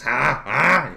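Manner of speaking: loud, high coaxing huh; drunken nudge. Retold or imitated. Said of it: "in a drunken stupor".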